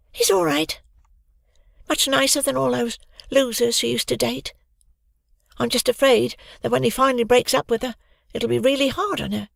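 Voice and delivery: put-on high, bright young girl. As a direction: voice Hoggy high and light. Narrator voices Hoggy.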